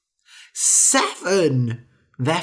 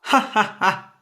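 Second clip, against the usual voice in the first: laughing; 7 semitones higher than usual. A narrator's reading. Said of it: "The tailor laughed".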